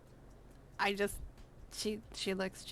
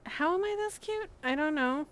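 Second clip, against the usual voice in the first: much higher and louder, delighted; gushing; 7 semitones higher, 6 dB louder than usual. Real, not acted. Streamer gushes over her own cute avatar, high delighted squeal. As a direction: squeal at how cute you are.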